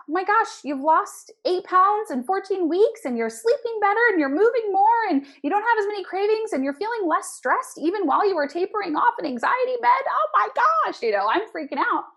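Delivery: high and loud, tumbling with delight; excited rave. Retold or imitated. Coach raving to her client about her results, thrilled. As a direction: rave about every win at once.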